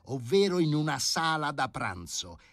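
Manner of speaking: furious professor. A narrator's reading. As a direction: tell the committee off, furious. (professore furioso che rimprovera il comitato, più alto e forte)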